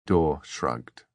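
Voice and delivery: usual speaking voice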